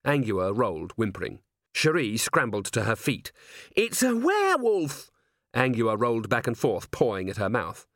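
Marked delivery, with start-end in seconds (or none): 3.8-5.1 high, drawn-out alarm; panicked cry. A narrator's reading. Dwarf discovering a werewolf beside her, panicked squeal. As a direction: squeal it in horror.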